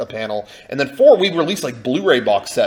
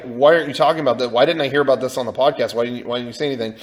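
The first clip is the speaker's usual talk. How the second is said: fast, pressing questions; much faster than usual. Retold or imitated. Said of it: "a lot of people kept asking".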